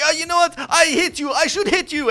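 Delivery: high, loud mock threat; hammed-up screechy menace; threatening customer. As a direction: imitate an outraged customer making threats. Imitating the customer threatening him.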